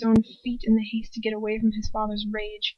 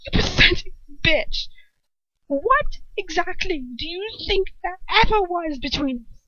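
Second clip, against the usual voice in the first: contemptuous scorn; 7 semitones higher than usual. A narrator's reading. Lucius scorning Bellatrix.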